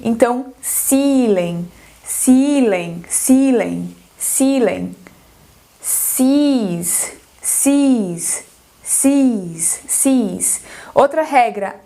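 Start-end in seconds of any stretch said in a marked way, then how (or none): 0.0-5.0 "ceiling" with a long I, slow; teacher modelling "ceiling" for students, long I. Shown as a demonstration. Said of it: "é esse I". 5.8-10.6 "seas" with a long drawn I, falling; teacher modelling "seas" for students, long I. Shown as a demonstration. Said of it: "é esse I".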